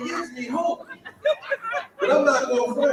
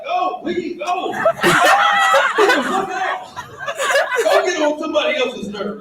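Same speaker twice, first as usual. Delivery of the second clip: high mock yell telling her to leave; mock shout; 7 semitones higher than usual. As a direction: shoo her off with a high, half-joking yell. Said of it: "I was like".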